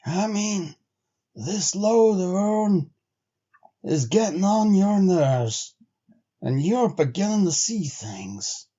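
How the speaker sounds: lightly voiced frontiersman, slow and dry; a hint of a grizzled sled driver; Henry, a weathered trail man. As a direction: give Henry a plain-spoken backwoods drawl. Henry's lines in the story.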